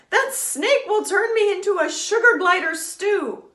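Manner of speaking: high loud frightened babble; scared glider blurting out his fear; panicked wail. Acted. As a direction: wail in terror. Terrified glider wailing.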